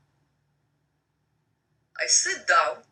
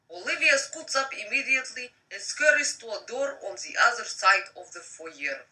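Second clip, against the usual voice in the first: plain reading voice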